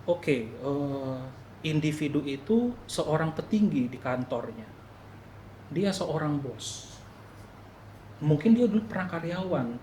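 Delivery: quiet, slowed-down musing; thinking to oneself. Retold or imitated. Speaker reasoning out the boss who scolded him, quiet musing. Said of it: "saya mulai pikirkan".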